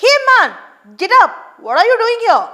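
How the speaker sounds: loud, high shout at the man; angry challenge. Acted. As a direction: confront the poacher. Watchman confronts the fisherman.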